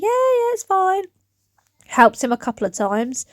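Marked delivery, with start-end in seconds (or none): 0.0-1.0 light son voice. Retold or imitated. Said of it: "And he was like".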